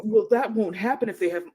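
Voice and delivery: normal talking voice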